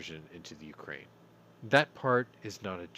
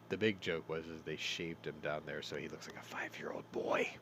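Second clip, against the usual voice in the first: unvoiced whispered joke; 5 semitones lower, 7 dB quieter than usual. Real, not acted. Host whispers the joke about his dad being shaved. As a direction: whisper it like a naughty secret.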